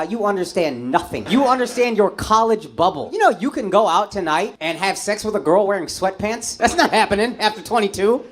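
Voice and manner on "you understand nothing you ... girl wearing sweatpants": usual speaking voice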